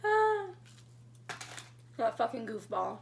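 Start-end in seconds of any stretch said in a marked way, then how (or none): 0.0-0.5 high, breaking-out laugh ending in "ah"; real giggle with a loud, high sigh after it; fond laughter. Real, not acted. Woman remembering her goofball partner, laughing fondly.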